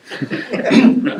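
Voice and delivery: short airy laugh rising at the end; a quick unvoiced chuckle; breathy laugh. A narrator's reading. Fisherman laughing to himself over the tarred catch, airy chuckle.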